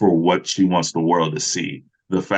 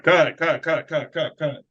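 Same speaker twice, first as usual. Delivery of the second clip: higher, hurried calls of cut; calling cut; 8 semitones higher than usual. Retold or imitated. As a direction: stop everyone fast. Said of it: "they're like".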